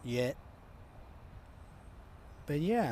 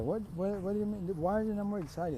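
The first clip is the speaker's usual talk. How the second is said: high-pitched, stammering disbelief; incredulous; 6 semitones higher than usual. Acted. Classmates challenge the unimpressed students, incredulous. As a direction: question it in baffled disbelief. Everybody baffled by their lack of excitement.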